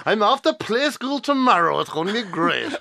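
high mock Kerry accent; small son talking like a Kerry elder; Jackie Healy-Rae. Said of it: "starting to sound like Jackie Healy-Rae".

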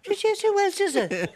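high laughing who else is it; laughing reply. Retold or imitated. Man answering the fella in the street, laughing. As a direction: laugh through the quip. Mock Irish street greeting.

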